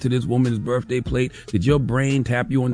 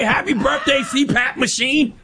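casual call-out; 10 semitones higher than usual. Retold or imitated. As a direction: sing out the nickname across the room. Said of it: "We will reduce people to whatever".

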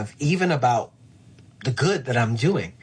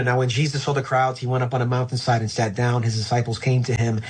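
rattling off memorized scripture. Retold or imitated. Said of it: "they were able to".

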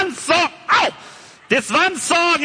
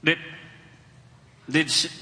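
ordinary talk

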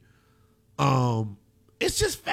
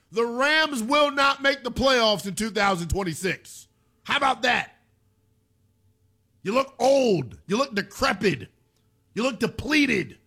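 raised-pitch sports-radio rant; defiant taunt; 7 semitones higher than usual. Real, not acted. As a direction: declare it high and emphatic, daring them. Defiant rant against the Rams.

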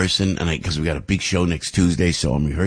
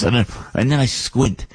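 usual speaking voice

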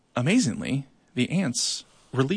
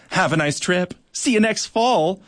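taunting; 6 semitones higher, 4 dB louder than usual. Acted. Crickwing taunting the tripped ants.

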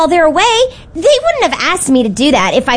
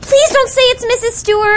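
high, whiny plea; high-pitched kid's dismay; pleading dread; 9 semitones higher than usual. Acted. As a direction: beg not to hear bad news. Child dreads the strict babysitter.

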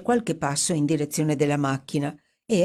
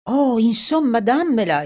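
higher and louder, throwing caution aside; reckless exclamation; 7 semitones higher, 6 dB louder than usual. Acted. Edward recklessly demanding the necklace from Dorothy, loud exclamation. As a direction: blurt it out boldly. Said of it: "esclamò Edward buttandosi allo sbaraglio".

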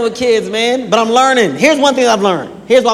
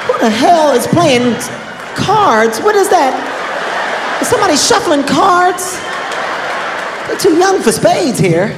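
higher, incredulous, slowed questions; hammed-up puzzled outrage; mock confused outrage; 7 semitones higher, slower than usual. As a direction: play baffled dad hearing cards. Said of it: "I said".

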